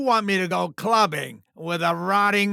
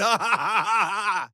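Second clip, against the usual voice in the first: loose, rolling "ha-ha-ha"; hearty showman's laugh; gloating laugh. Acted. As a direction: laugh it up, delighted by the ratings. Delighted by ratings.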